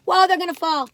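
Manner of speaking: sudden alarmed whoa; loud and startled, catching it; startled exclaim. Real, not acted. Chips spilling out.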